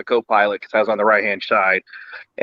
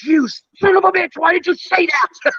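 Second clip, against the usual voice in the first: much higher mock-outraged yelp ending in a laugh; mock outrage. Friend realizing they're under the fantail, high mock outrage. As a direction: squeak out fake betrayal, then laugh. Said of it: "looked at me and goes".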